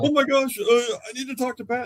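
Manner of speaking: high-pitched mock panic; panicked plea. Friend phones officer's wife after close call, panicked plea. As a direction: imitate a rattled caller needing help.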